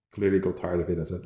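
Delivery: ordinary talk, nothing marked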